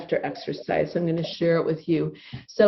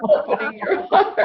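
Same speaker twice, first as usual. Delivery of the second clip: much higher, breathy laughing words; 11 semitones higher than usual. Shown as a demonstration. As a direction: talk through the laughter. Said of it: "there's forgiveness laughter".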